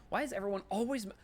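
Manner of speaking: a jittery shop lady begging you to stop; panicked shopkeeper. Acted. Shopkeeper complains about curtains.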